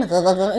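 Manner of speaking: low throaty gurgle; quick gargling noise from the throat; imitated gargle. Shown as a demonstration. Mimicking the ghost woman's water noise, low gargle. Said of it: "Nah, suaranya kayak gitu".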